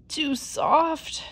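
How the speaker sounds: put-on high, put-out girl's voice; fussy about the bed; girl complaining. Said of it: "she said".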